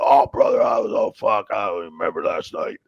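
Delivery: loud gravel-free shouted brother voice; a loud mock Sandman shout. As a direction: shout it like a hungover wrestler. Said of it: "He's like".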